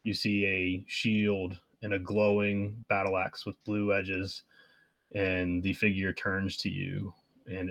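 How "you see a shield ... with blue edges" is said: usual speaking voice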